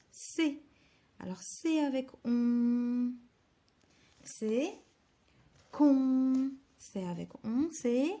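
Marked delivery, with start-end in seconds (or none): 5.7-6.5 con said loud and clear; emphatic syllable. Shown as a demonstration. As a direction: announce con to the class. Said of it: "teacher announces the syllable". (annoncer con fort)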